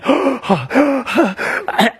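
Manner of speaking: high startled gasp of panic; mock gasp. Imitating a customer's panicked gasp. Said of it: "She was literally hyperventilating, freaking out, going".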